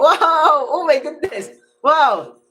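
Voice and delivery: high excited gasp of delight; bright laughing amazement; excited wow. Real, not acted. Host excited seeing the guest.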